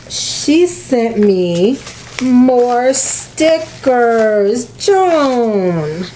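slow teasing build to the surprise; slow reveal. Real, not acted. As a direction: stretch each word out as you unveil it.

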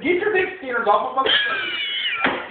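high, slow, emphatic demand; acted-out indignant command; angry order. Acted quarrel, ordering her off.

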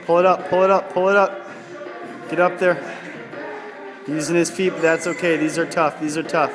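usual speaking voice

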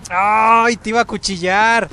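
loud, high angry rant; angry shouting. Real, not acted. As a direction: shout it in gamer rage. Killed mid-attack, raging.

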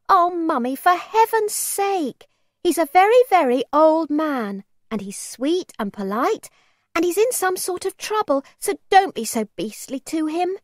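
high, loud, exasperated plea; embarrassed child pleading. A narrator's reading. Daughter begs her mother to be kind, exasperated.

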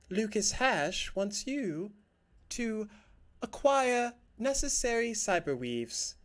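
high-pitched promotional announcement; woman's advert voice. Acted. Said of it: "this woman's voice comes over".

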